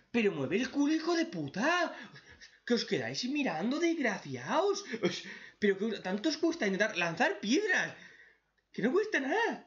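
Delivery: hammed-up outrage at useless allies; mock furious rant. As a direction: tear into cowards who just stand there. Ranting at cowardly characters.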